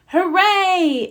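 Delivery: bright high cheer of the whole crowd. Acted. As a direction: cheer the monster away. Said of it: "everyone cheered".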